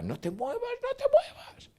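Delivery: very high-pitched imitated cry; imitated yelling. Sancho warns the cameraman in the horses' path, imitated yell. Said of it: "y gritaba".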